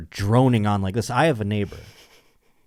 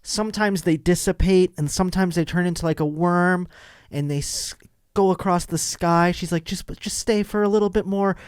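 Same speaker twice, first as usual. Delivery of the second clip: lightly high, earnest neighbor insisting; imitating neighbor; 7 semitones higher than usual. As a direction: plead it like a spellbound stargazer. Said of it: "she starts going".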